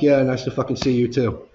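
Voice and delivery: sarcastic comeback. Retold or imitated. Worker answering his manager's curt greeting, sarcastic. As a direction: fire back with loud mock politeness.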